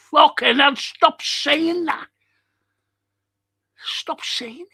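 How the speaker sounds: high-pitched exasperated outburst, loud then easing off. Real, not acted. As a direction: plead in a high, fed-up voice. Fed up with a viewer's comment.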